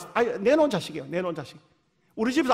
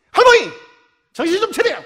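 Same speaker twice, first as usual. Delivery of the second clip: sharp high shout to wake her; 10 semitones higher, 8 dB louder than usual. Retold or imitated. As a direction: yell her awake from the pulpit. Pastor scolding dozing grandmother.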